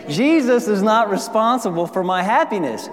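preaching. Acted. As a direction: drive the truth home to the crowd. Preaching repeated line.

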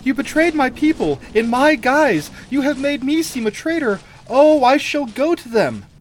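loud, very high indignant outburst; betrayed queen crying out in full dramatic flow; outraged cry. A narrator's reading. Queen accusing her sister of betrayal, crying out. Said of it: "cried Taramis".

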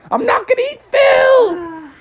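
very high, slow shouted plea through laughter; laughing pained shout. Acted. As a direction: shout the denial while being poked. Poked with pitchforks.

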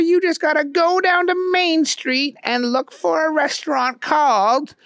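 high, odd put-on voice; squeaky character voice; Keith voice. A narrator's reading. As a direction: talk it up like a know-it-all pal. Keith gives directions.

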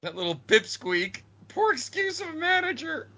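high mocking announcer voice; ring announcer. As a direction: sneer it like a bad announcer. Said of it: "He's reading cue cards".

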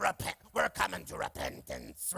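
mock fiery preacher. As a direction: parody the over-the-top pulpit preacher. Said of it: "how it gets preached in church sometimes".

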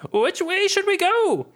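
high, slow, drawn-out question; high anxious call. Acted. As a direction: ask it high and stretched. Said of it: "you shout to others".

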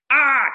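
sharp cry as if bitten; pained gasp. Retold or imitated. Actor reenacting being bitten mid-line, a sharp pained gasp. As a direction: yelp out in sudden pain. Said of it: "I also have to be like".

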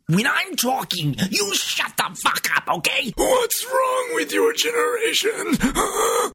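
very high, hammed-up tirade; high-pitched mock outrage, building to the end; mock angry scolding. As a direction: imitate an exasperated elder, climbing to a screech. Mimics frustrated old man scolding others.

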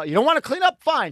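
high angry shout of a fed-up mother; furious mom. Retold or imitated. Mother yelling at kids who won't clean up, high furious shout. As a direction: snap it out, high and loud. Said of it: "just flipped her shit".

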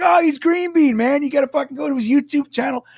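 high-pitched imitated hype; enthusiastic friend. Friend explains him to the stranger, imitated enthusiasm. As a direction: talk him up like an eager fan. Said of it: "So Jason was like".

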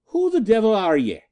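high, loud Irish woman's indignant demand; outraged Irishwoman. A narrator's reading. Irishwoman confronting police on stairs, outraged high demand. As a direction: bellow at the intruders as a fiery landlady. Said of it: "demanded an outraged voice".

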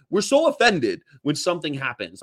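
ordinary talk

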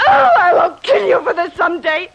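loud, high, vengeful cry; furious threat. Acted. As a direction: spit the threat out. Woman threatening her attacker.

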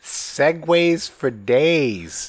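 stretched out slowly; drawn-out teasing line; slow drawl. Real, not acted. Drawn-out joking remark.

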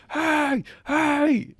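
high, loud shouts after a thief; mock yell. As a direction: call out chasing the eagle. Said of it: "He's like".

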